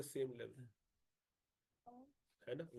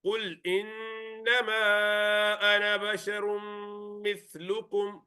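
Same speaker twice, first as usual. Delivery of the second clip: higher melodic tajweed recitation; Quran recitation; 6 semitones higher, 5 dB louder, much faster than usual. Shown as a demonstration. Teacher recites verse.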